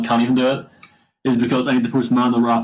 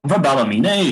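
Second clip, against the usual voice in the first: swooping, sing-song Ballymena accent; hammed-up Ballymena accent with a big pitch swoop; put-on Ballymena accent; 3 semitones higher than usual. Shown as a demonstration. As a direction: do a mock Ballymena lilt. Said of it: "doesn't even sound Ballymena".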